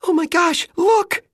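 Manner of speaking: high, loud squeal of excitement; excited squeal. A narrator's reading. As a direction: squeal it out, thrilled and shrill-high. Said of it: "Nate squealed".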